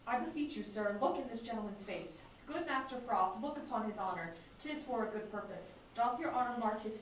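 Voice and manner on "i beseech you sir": ordinary delivery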